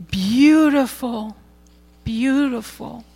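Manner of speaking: slow, soft repeated wonder; savoring awe. Real, not acted. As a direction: linger on each word in awe. Preacher savoring the story.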